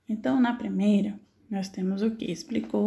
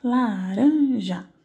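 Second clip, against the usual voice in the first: Laranja said very slowly and loud, syllable by syllable; syllables drawn out; 2 semitones higher, 6 dB louder, much slower than usual. Shown as a demonstration. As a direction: stretch out La-ran-ja for the class. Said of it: "laranja é átona". (Laranja bem devagar e alto, sílaba por sílaba)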